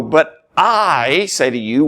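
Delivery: slow, with "I" drawn out long; emphatic. Shown as a demonstration. Preacher showing how we emphasize it by inflection, emphatic. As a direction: put all the weight on a lengthened "I". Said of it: "but by the inflection, the tone".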